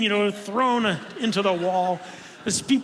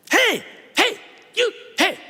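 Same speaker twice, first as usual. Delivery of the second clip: high, loud mock call of a pushy stranger; hammed-up heckling woman; imitating a woman who barges in, much higher and louder; 13 semitones higher, 5 dB louder than usual. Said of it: "she just kinda leans over me and goes".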